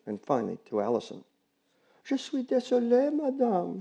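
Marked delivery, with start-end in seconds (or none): 2.0-3.8 put-on high young girl's voice in French. Girl apologizing to the neighbor in French, high girlish voice.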